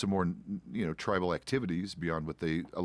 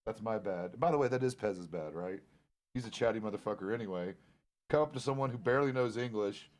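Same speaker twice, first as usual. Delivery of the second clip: ordinary talk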